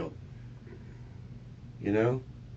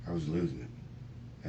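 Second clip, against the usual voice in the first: softer and slow, almost unvoiced; faint, slowed near-whisper; 5 semitones lower, 9 dB quieter, slower than usual. Real, not acted. Suspect telling detectives he was losing it, hushed. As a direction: let the words sink to a whisper.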